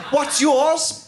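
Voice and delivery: high robber asking a name. Retold or imitated. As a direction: ask it pitched well up in the robber's voice. Robber voiced in story.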